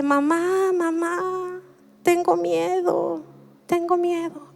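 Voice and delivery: soft, high little whimper of fear; imitated tearful child. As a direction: imitate a frightened toddler calling for mom. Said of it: "se despierta llorando y me dice". (imitando a una niña llorosa, suave y agudo)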